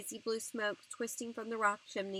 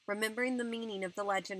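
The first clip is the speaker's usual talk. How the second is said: ordinary delivery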